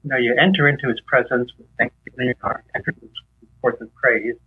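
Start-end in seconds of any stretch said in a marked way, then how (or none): none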